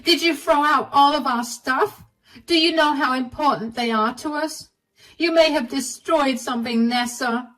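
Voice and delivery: shouting. Acted. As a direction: yell it in her face, outraged. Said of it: "started shouting in my face".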